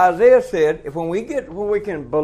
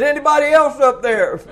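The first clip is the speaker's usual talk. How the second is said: laughing punchline; 9 semitones higher, 5 dB louder than usual. Retold or imitated. Man asking for another answer.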